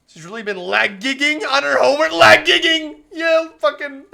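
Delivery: mock the excuse loudly. Real, not acted. Mocking lag gigging excuse.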